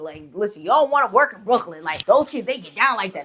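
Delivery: ordinary delivery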